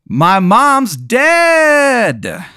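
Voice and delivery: high, loud, very slow drawn-out punchline; catchphrase delivery. Acted. Comic closing the set with his catchphrase, stretched high and loud. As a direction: land the catchphrase big and stretched. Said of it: "You go, um".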